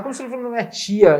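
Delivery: imitating his own confusion on the call; mock incredulous demand; retold disbelief. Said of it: "Eu falei, falei".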